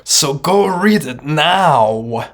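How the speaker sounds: drawn-out, emphatic order to go; mock command. Lecturer urging viewers to read the text, drawn-out mock command. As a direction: stretch the words into a big mock command.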